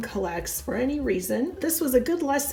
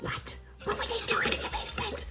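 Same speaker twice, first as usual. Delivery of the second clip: high, fast, breathless whisper of alarm; whispered shock; 10 semitones higher, 9 dB quieter, faster than usual. Acted. Wife to husband at the doll room news, whispered alarm. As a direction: hiss it out in a panicked whisper. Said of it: "Here's how I wanted to react".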